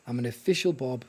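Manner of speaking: usual speaking voice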